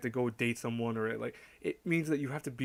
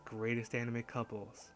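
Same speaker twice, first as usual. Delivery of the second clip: slow, soft, put-on seductive announcer; sensual host voice; 4 dB quieter than usual. Host welcomes viewers to a Valentine's Day countdown, mock-sensual voice. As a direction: play a sultry countdown host, drawing the words out. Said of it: "listen to my sensual voice".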